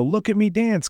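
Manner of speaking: mock showboat. Mocking a showboating wrestler.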